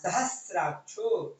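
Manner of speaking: सहस्राक्षो slowly, with स्रा after हा, no त; clear Sanskrit pronunciation. Shown as a demonstration. Teacher models the correct reading for the student, slow and careful. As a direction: recite सहस्राक्षो syllable by syllable, the स्र cluster clean. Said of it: "हा के बाद स्रा आया है ना".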